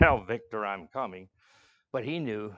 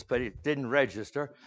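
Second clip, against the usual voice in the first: usual speaking voice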